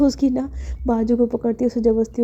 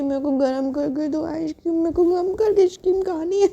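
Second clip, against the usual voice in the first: whiny demand; 7 semitones higher than usual. Acted. Maya begging for warmed ice cream, whining. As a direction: pitch climbing into a childish whine.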